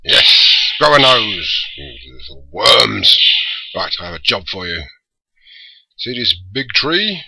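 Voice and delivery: booming overlord barking orders at minions; loud commanding villain voice; big deep voice. Acted. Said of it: "big, deep voice".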